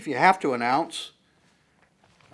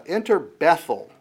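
scripture reading; 5 semitones higher, 8 dB louder than usual. Acted. Reads scripture with emphasis.

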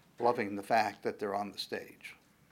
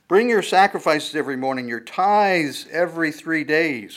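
emphatic reading; 4 semitones higher, 9 dB louder than usual. Acted. Reads scripture loudly.

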